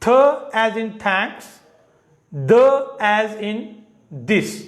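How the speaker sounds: थ as in thanks, the as in this, dragged out slowly; slow classroom drill. Shown as a demonstration. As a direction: spell each sound out slowly for students. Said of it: "As in का मतलब होता है जैसा के".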